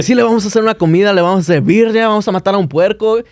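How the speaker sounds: high, fast eager invite; excited invitation. Retold or imitated. Dad inviting strangers to party, fast excited. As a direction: rush the party plans.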